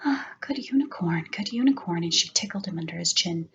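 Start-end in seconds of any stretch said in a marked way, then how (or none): 0.0-2.0 soft unvoiced whisper. Zoe whispering to the sleeping unicorn. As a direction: hush the unicorn tenderly. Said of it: "She said".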